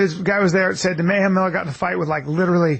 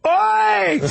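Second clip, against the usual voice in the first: high, drawn-out strike cry; imitated fight yell; 12 semitones higher than usual. Retelling Mayhem stepping out to fight, high shout. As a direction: yell it long as if landing a blow. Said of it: "steps out and just goes".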